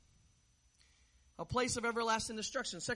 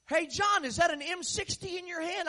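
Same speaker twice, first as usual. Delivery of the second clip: calling out; 7 semitones higher, 4 dB louder than usual. Retold or imitated. Raymond calls out a warning.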